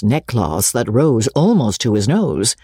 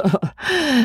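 high, embarrassed little laugh; a flustered titter; lightly acted nervous giggle; 14 semitones higher than usual. A narrator's reading.

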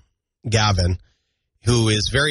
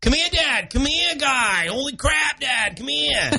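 very high, hammed-up Maine toddler; toddler's Maine accent; 13 semitones higher, slower than usual. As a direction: squeal like a Budweiser-ad kid with Maine accent. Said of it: "toddler version of a friggin' Budweiser commercial".

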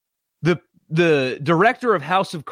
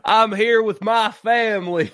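laughing, slow, higher exaggerated Southern drawl; mock Southern accent; 8 semitones higher than usual. Host mocking the coach's fake accent, laughing Southern drawl. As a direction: parrot his put-on accent while cracking up. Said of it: "That's not how you talk".

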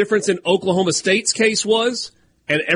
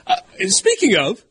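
ordinary talk, nothing marked

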